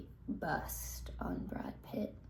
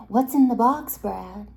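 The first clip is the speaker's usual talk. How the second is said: raised, much louder mock demand; 2 semitones higher, 13 dB louder than usual. Friends teasing Brad about his takeaway box, loud demand. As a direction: burst out demanding an answer.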